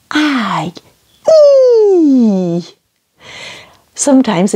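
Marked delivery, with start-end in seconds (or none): 1.3-2.6 high "E" held as "ee", sliding down; long French E. Shown as a demonstration. Teacher shows how French sounds the letter I, long high "E". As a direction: stretch "E" out as a long "ee". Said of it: "is quite a long E".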